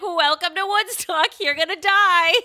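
high, loud mock welcome; creepy host. Backwoods host greeting Airbnb guests, hammed-up loud menace.

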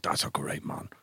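hushed, reverent whisper. Retold or imitated. Recalling a radio caller's tribute in an awed whisper. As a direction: breathe it in awe.

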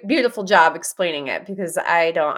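ordinary talk, nothing marked

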